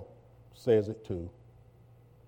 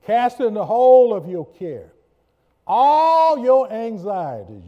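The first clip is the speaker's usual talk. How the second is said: preached scripture; 10 semitones higher, 12 dB louder than usual. Acted. Pastor reading Amplified verse, loud and high. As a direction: call the verse out to the room.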